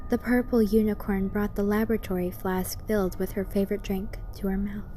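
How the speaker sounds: ordinary delivery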